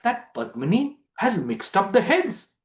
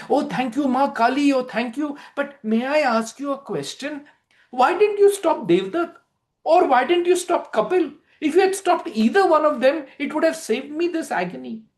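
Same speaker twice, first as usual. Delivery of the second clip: high, bright gushing questions; eager girl; 8 semitones higher than usual. Acted. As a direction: thank the goddess then press her. Padmini thanks and questions Kali.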